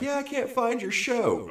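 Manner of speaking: high, slow whiny quote; mock a lazy fan's excuse, slowly. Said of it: "when they're like".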